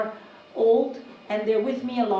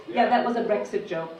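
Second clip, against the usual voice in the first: ordinary talk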